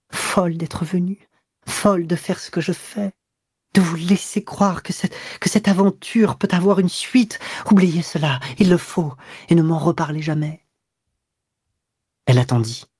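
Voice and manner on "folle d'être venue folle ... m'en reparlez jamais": higher, pleading and distressed; anguished plea. Acted. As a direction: beg him to forget it all. Guilty woman pleads to forget.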